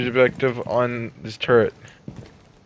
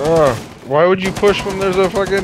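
loud exasperated complaint, pitched up; frustrated outburst; 7 semitones higher, 4 dB louder than usual. Real, not acted. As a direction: vent at a reckless teammate. Teammate pushed too hard, frustration.

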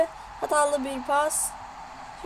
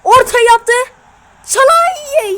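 excited commentary; 7 semitones higher, 10 dB louder, slower than usual. Real, not acted. Attack near goal.

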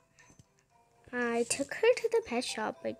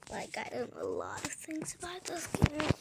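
whispering through a sore throat; barely voiced, throat too sore to speak; sick whisper; 4 dB quieter than usual. Real, not acted. Gamer apologizing to viewers, sore-throat whisper. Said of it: "I'm so sorry, guys, that I sound like this".

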